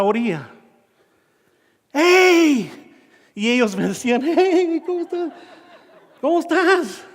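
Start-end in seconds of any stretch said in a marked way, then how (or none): 1.9-2.7 high, loud, drawn-out hail; acted distant shout for rescue; retold cry for help. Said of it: "Grité por ayuda". 4.2-7.0 high, distant-sounding mock greeting; light, high-pitched call-back; youths calling back.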